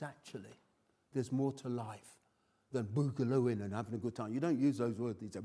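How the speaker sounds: ordinary talk, nothing marked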